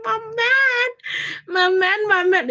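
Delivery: high mock-tearful swooning; hammed-up lovestruck wail; gushing adoration. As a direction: coo it proudly, high then easing down. Gushing over boyfriend in retelling.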